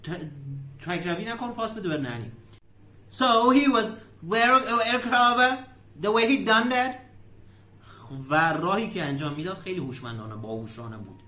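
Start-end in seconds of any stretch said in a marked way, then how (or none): 3.1-6.9 emphatic repetition. Shown as a demonstration. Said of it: "teacher repeats clip line".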